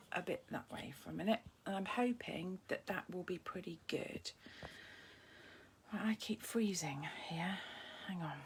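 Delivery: half-whispered, muttering to herself while adjusting; whispered asides. Real, not acted. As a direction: talk under the breath while fixing the camera. Fiddling with the camera mid-stream.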